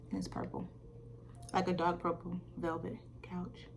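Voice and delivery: a gossipy, hushed whisper. Acted. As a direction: whisper it like a juicy secret. Gossipy aside about his couch.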